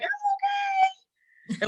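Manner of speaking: very high, slow, quavering imitation; mock wobbly voice. Imitating the friend after she fell, high and drawn out. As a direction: squeak it out like someone brushing off a fall. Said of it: "it's like".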